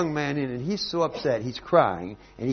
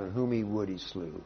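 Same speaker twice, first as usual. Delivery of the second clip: ordinary delivery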